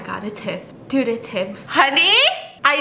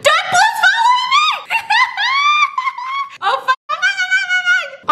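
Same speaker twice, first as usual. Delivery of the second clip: high panicked laughing shriek; panic-shout while chased; panicking gamer; 5 dB louder than usual. Real, not acted. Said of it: "I'm so scared, bro".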